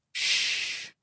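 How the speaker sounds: soft, voiceless shush; hushing "shh". Acted. Friend hears footsteps behind them, urgent hush. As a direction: hush a companion under your breath.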